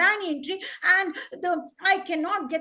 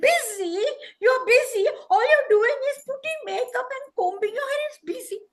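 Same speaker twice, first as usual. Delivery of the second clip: high, loud, fast outburst; scornful, raised-voice protest; indignant; 10 semitones higher, 6 dB louder, much faster than usual. Acted. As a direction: throw the excuse back in disbelief. Crow scoffs at busy queen.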